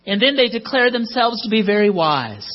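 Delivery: ordinary talk, nothing marked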